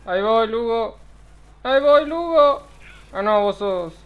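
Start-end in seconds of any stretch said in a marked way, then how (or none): 0.1-1.0 loud, high call to Lugo; calling to Lugo in game. Real, not acted. Calling out to teammate. 1.6-2.6 much louder, higher shout; shouting to Lugo, louder. Real, not acted. Louder repeated call.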